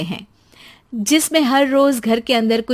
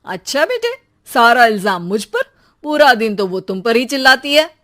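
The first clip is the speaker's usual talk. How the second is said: indignant father; 9 dB louder than usual. Acted.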